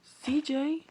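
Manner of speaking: high breathy whisper calling a name. Acted. Alexis searching for CJ, high whispered call.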